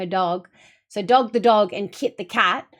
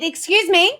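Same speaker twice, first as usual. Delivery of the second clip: high, loud mock-outraged "excuse me"; mock indignation; 8 semitones higher, 6 dB louder than usual. Streamer reads out her message to best friend, mock indignant. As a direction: demand attention in playful outrage.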